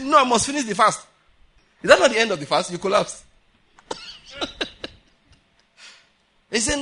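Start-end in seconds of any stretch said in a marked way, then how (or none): 0.0-1.1 higher, louder refusal; mock insistence. Preacher mimicking a collapsed faster refusing food, mock insistence.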